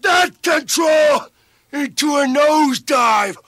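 high, furious shouted command; screamed order. Acted. Commander screaming the order into the nosedive, full shout. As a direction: scream it at the top of your lungs.

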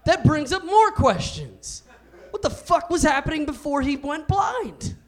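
high-pitched, loud incredulity; baffled outburst. Acted. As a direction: demand an explanation, voice pushed way up. Baffled outburst after friend's punchline.

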